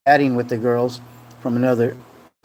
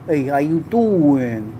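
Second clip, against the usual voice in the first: very slow, drawn-out come-on; Joey Tribbiani; much slower than usual. Acted. Streamer answers chat's greeting, hammed-up sitcom flirt. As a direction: stretch it into a sitcom pickup line. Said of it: "Like Joey, Joey Tribbiani".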